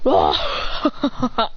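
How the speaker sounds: yelp, then choke out broken breaths; stuttering pained "ah"; hit by attack. Acted. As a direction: cry breaking into stuttered gasps. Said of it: "He goes".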